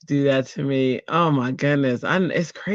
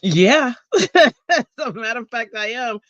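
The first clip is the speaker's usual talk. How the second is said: mock eager reply; 7 semitones higher than usual. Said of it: "And I was like".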